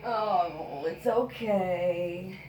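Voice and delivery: slow, choked with tears; fighting tears, whispering it's okay; holding back tears. Real, not acted. Mother breaking into tears on camera.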